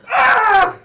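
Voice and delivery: loud high laughing shriek; mock scream. DM voicing the fall from the drifting ship, laughing shriek. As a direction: yelp as if falling off a ship. Character flung off flying ship.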